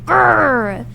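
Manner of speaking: drawn-out "grr" of annoyance; exasperated grr; frustrated growl. Real, not acted. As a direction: growl at the hated puzzle. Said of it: "I hate this puzzle".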